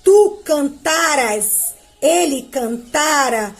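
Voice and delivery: slow, each form set apart; slow conjugation drill. Shown as a demonstration. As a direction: recite slowly for the class. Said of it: "Ó o cante aqui". (conjugação lenta, forma por forma)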